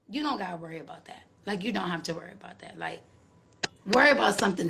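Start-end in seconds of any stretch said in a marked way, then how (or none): none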